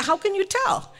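high-pitched incredulous question; baffled husband. Retold or imitated. Baffled husband asks his wife, high-pitched.

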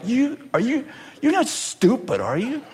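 hushed mock disbelief; incredulous disciples. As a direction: probe gingerly in disbelief. Disciples doubting a fellow disciple.

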